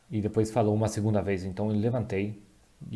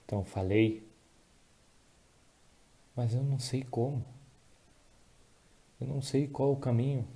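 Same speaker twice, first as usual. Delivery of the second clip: real emotion, soft and halting; choked up; 4 dB quieter than usual. Real, not acted. Preacher recalling God's answer on the plane, choked up. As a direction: struggle to get the words out, with long pauses. Breaks off, long pauses, apologises.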